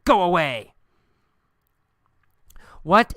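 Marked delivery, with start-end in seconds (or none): 0.0-0.7 mild demonstration yell; a contained, angry-style shout; retold yell. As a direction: act out yelling at him, held back. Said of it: "and I yell at him".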